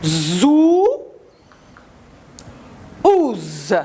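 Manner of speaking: ZOU and OUZ held long and strong; teacher modelling ZOU, then OUZ, separately; syllable drill. Shown as a demonstration. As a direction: stretch the ou and buzz the z. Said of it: "Le son ou".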